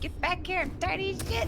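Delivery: high, mock angry chase cry; excited yell. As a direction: shout after the fleeing player. Voicing player chasing opponent.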